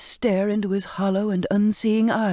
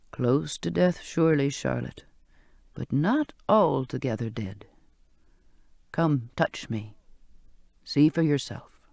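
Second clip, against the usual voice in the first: put-on old sailor's voice; 4 semitones lower than usual. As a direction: coax her gently to touch him. Old man reassures Charlotte.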